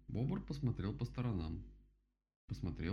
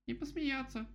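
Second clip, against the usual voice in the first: very high little squeak; squeaky vole. A narrator's reading. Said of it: "пропищала Полёвка". (тоненький писк полёвки)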